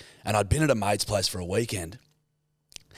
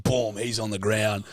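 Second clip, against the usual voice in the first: ordinary talk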